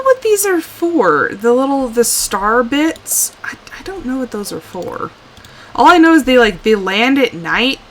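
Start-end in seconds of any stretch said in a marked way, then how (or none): 3.4-5.1 whispered, sheepish admission. Real, not acted. Player confessing to viewers she's clueless, whispered.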